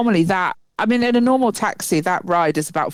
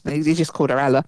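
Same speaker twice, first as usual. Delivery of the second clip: usual speaking voice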